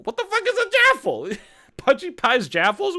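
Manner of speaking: high-pitched, loud incredulous yelp; baffled outburst. Real, not acted. Host reacts to an unknown pie name, baffled outburst. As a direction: blurt it out in shrill-pitched disbelief.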